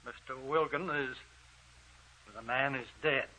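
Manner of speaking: hushed. Acted. Doctor finds body.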